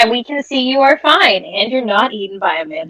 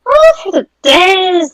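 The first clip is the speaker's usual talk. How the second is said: high sudden oh; startled cry; 9 semitones higher than usual. Real, not acted. Player answers the old midwife, a startled high cry. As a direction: blurt it out caught off guard.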